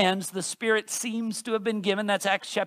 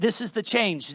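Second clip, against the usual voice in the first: normal talking voice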